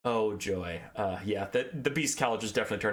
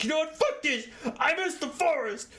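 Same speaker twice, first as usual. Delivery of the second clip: bestial rage; 14 semitones higher than usual. Retold or imitated. The Patriarch wrecking his room, shrieking high in fury. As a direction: throw a wild tantrum, shrieking high. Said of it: "in a feat of, fit of bestial rage".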